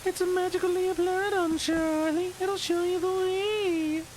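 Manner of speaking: high, slow, airy oracle voice; mystical guide voice. Acted. Telling Charlie the magic creature will show the way, high and slow.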